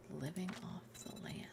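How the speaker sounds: slow breathy whisper, barely voiced. Shown as a demonstration. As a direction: whisper it slowly like a secret. Repeats phrase whispering.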